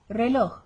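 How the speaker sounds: "reloj" with a barely audible final j; just a slight tick in the throat ending "reloj". Shown as a demonstration. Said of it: "slight tick in the throat".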